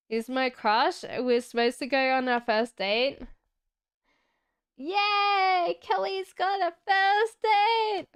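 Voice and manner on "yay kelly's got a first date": high, bright cheer of joy; excited cheer. Real, not acted. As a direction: cheer for her news, pitched up high. Cheering a viewer's first date.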